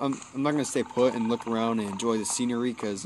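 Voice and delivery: ordinary talk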